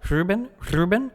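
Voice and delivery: high, rising Ruben with a trilled R; rolled R. Shown as a demonstration. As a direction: test out a rolled R on Ruben, questioningly. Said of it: "do you roll his name?".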